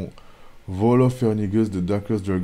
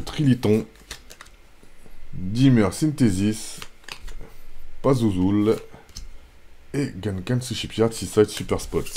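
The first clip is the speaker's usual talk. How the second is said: usual speaking voice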